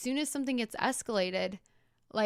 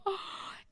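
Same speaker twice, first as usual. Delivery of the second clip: sharp gasp; 10 semitones higher, 8 dB quieter than usual. Retold or imitated. Reacting to the screaming lady.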